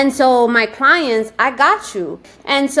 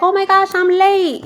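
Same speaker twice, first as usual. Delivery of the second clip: much higher, breathless-sounding hurry; flustered apology; 8 semitones higher than usual. Retold or imitated. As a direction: play the regular rushing in late. Janet arriving late, flustered.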